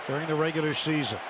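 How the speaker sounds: usual speaking voice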